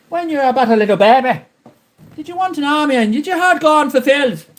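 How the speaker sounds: high, hammed-up probing voice; lightly high sing-song questioning; mock interviewer. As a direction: play a syrupy host who coaxes confessions.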